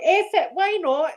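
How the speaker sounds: high, giggly devil-may-care; egging each other on, laughing; tipsy excitement. Retold or imitated. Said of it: "We're like".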